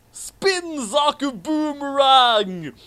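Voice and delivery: high, slow, hammed-up battle cry; anime attack shout. Reading the fanfic aloud to friends, a slow high anime attack yell. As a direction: bellow the move name like a cartoon hero.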